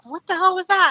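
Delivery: high-pitched, incredulous outburst. Retold or imitated. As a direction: demand an explanation in shrill disbelief. Acts out astonished reaction to guide.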